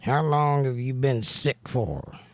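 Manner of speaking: ordinary talk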